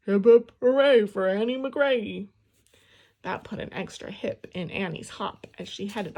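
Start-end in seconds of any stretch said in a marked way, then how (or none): 0.1-2.3 loud, mock deep father's cheer; deep dad voice. A narrator's reading. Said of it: "she made a deep voice like her father's".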